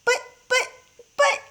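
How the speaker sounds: high, halting stammer; a shocked mother stammering. A narrator's reading. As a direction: stutter it out, too stunned to finish. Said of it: "stammered George's mother".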